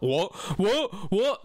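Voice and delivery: dopey, dim repeated what; dopey bassist. Acted. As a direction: play him slow to catch on, pitch jumping on the repeats. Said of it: "This is how dope he was".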